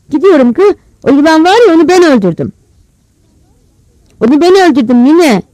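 put-on high, loud childlike insistence; boy's insistent protest. A narrator's reading. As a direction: call out your feat to an uninterested grandmother. Boy protests proudly to grandmother.

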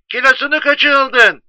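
very high, loud screech of a question; mocking wife. Wife ridiculing her husband's wisdom, high mocking shout. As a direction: shriek it back down the phone. Wife mocks him on telephone.

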